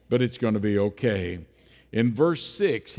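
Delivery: ordinary delivery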